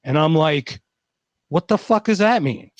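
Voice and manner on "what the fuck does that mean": higher, incredulous what-the-fuck; baffled outburst. Retold or imitated. Client hearing he is from another planet, baffled outburst. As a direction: blurt it out, completely thrown. Baffled reaction to Sirius claim.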